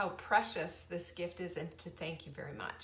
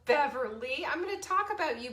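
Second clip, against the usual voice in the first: normal talking voice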